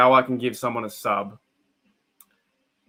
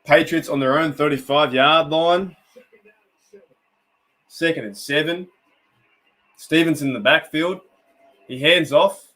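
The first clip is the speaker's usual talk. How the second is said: steady sportscaster play-by-play, a little louder; play-by-play call; 2 semitones higher, 5 dB louder than usual. Acted. Streamer to his viewers, calling the drive as a play-by-play announcer. Streamer calls the game live.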